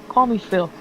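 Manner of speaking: put-on a light Jersey accent on the bobcat's line; tossing it off in a loose Jersey accent. Teasing the player, redoing the bobcat's line in a mild Jersey accent. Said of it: "Phil has a Jersey accent".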